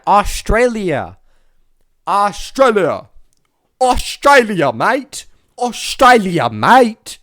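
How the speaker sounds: loud, exaggerated Aussie accent; mock Aussie accent, repeated with growing relish; Australian accent. Shown as a demonstration. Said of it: "that was my Australian accent".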